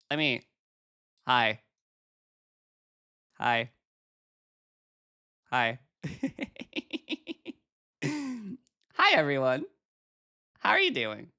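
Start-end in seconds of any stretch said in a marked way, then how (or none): none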